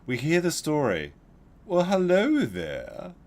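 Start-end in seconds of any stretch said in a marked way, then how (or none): 1.6-3.1 high, slow, theatrical greeting; flamboyant old neighbor. Retold or imitated. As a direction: drawl it out like a flamboyant old man. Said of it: "the way the guy said it was so flamboyant".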